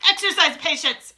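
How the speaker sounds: put-on loud, high, stern witch with an accent; Grand High Witch. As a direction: command patience sharply. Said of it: "answered the Grand High Witch".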